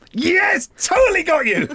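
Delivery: high, loud gotcha; triumphant gloat. Real, not acted. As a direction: crow over the win. Host triumphantly gloats, got you.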